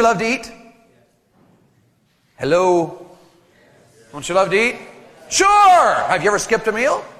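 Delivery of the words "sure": high, loud, drawn-out yes; emphatic agreement. Acted. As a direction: belt out an obvious answer for the crowd. Answers own question to audience.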